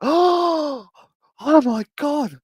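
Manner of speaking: very high, gasping cat voice; hammed-up high-pitched freak-out, with gasps; losing it with amazement. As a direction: squeal in awe like an astonished cat. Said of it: "losing her shit about the fucking heater".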